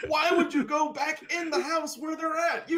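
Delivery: loud, very high-pitched heckle; exasperated mock yell. Moviegoer to characters going back in the house, exasperated yelling. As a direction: yell in disbelief at a foolish character. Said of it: "definitely yell them".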